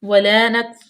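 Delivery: ordinary talk, nothing marked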